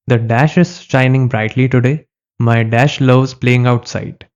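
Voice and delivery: very slow, deliberate sentence reading. Shown as a demonstration. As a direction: dictate the question for students. Reading quiz sentence to class. (बहुत धीरे वाक्य पढ़ना)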